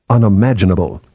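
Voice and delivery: plain reading voice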